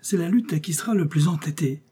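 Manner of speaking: high-pitched attempt at banter; forced joking. A narrator's reading. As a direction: try to play it off as a joke. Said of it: "voulant plaisanter encore".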